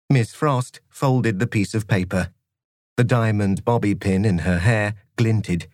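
usual speaking voice

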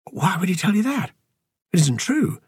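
high-pitched, indignant denial; defensive protest. A narrator's reading. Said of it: "Andy defended his claim".

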